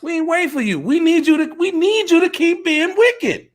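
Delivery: high-pitched put-on voice of the oppressors; voicing the wicked oppressors. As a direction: speak as the scheming nations, pitched well up. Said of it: "the spirit that they walked around in".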